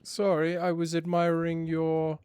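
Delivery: hammed-up posh English accent, pitched high; shitty posh voice. Poshly voicing his own character to party guests, hammed-up English accent. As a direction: play a pompous English aristocrat touring a party. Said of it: "devolve into a posh version of myself".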